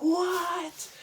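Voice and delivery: high-pitched, incredulous outburst; freaked-out disbelief. Retold or imitated. Fan reliving the new champ's win, high freaked-out squeal. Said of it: "I was freaking out".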